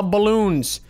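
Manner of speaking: ordinary talk, nothing marked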